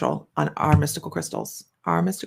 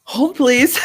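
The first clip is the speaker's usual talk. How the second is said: laughing stall, higher and louder; 4 dB louder than usual. Real, not acted. Host stalling a hard question, laughing. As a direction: hold them off through a laugh.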